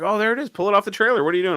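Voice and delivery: high-pitched voice of people egging him on; mimicked eager onlookers. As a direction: call out high, imitating an impatient crowd. Acts out onlookers urging him on.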